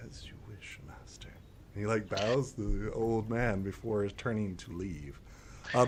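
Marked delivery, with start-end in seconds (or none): 0.1-1.3 whispered, much softer; whispered obedience. Acted. Guard elf whispering to his master before leaving. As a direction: murmur it with a bow.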